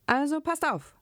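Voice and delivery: high-pitched narrated cry for attention; mild shout. A narrator's reading. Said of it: "schrie der Magister". (mit hoher Stimme gemäßigt gerufen)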